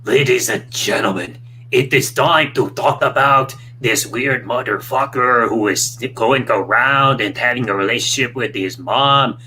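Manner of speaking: slow Canadian rant voice; slow, drawn-out announcer rant; Wrestling Jesus voice. Retold or imitated. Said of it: "It's like".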